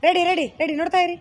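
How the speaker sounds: repeated, forceful on-set cue; real loud cue. Real, not acted. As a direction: call the cast to attention. Director cueing actors before take.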